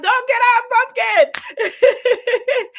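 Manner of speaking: high, loud, drawn-out playful cheer; group rallying yell. Shown as a demonstration. Host imagines the whole gathering yelling a slogan together, playful yell. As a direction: yell it out as a slogan, laughter bubbling round it. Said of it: "we all yell out".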